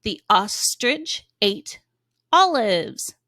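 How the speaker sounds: slow, word by word reading; slow read-along. Shown as a demonstration. Teacher reading along slowly with kids. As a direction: read slowly as fingers track the words. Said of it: "go under the words left to right with me".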